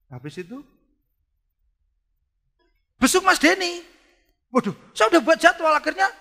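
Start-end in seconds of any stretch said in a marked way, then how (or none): 3.0-6.1 flustered. Retold or imitated. Frantic retelling of busy schedule.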